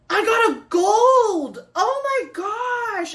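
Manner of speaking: loud, very high, drawn-out squeal of joy; excited squeal. Real, not acted. Vlogger pulls a rare gold card, squealing with excitement. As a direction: shriek it out, stretching the words.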